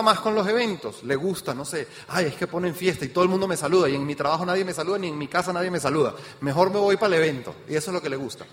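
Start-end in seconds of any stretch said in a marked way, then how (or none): 2.1-7.5 quick, chatty mock event fan; rattled-off imitation. Event fan explaining why they go, rattled off quickly. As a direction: rattle off reasons in one run. Acts out an event fan's reasons.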